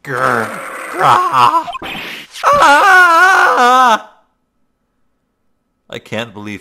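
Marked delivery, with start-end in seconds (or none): 0.0-1.7 growl, then high loud roar; tiger roar. Acted. Tiger attacks. 2.4-4.2 high loud scream of pain. Acted. Mauled by tiger.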